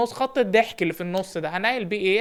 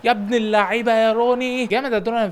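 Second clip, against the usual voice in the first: loud, high cheer for Rooney; goal cheer; 4 semitones higher, 5 dB louder than usual. Real, not acted. Coach celebrates his player's goal with a loud cheer. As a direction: shout the praise after the goal.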